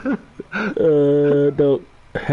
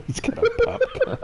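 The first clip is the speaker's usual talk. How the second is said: laughing; 5 dB quieter than usual. Real, not acted. Laughing repeat of quote.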